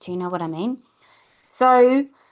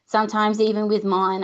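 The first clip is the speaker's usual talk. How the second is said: normal talking voice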